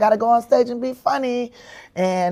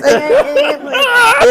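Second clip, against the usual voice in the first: much higher, very slow, fake-crying whine; whiny wailing protest; 6 dB louder, much slower than usual. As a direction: wail it like a kid about to cry. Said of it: "I'm like".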